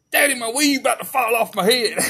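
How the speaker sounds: put-on high whimpering kid voice; whiny little boy. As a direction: play the scared little boy in the car. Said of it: "my little boy goes".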